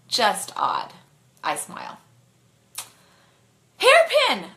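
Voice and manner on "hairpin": sharp loud shout of warning. Acted. The passenger warns of a sharp turn, shouting loudly. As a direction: yell it to warn the driver. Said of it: "I say loudly".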